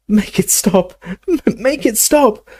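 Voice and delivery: high-pitched anguished moan; moaning plea. A narrator's reading. Dumbledore begging Harry to stop the pain, high moaning plea. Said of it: "moaned Dumbledore".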